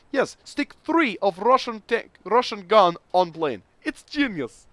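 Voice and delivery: high, loud hammed-up Russian accent; terrible Russian accent. A Soviet engineer pitching his plan, in a hammed-up Russian accent. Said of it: "Forgive the terrible Russian accent".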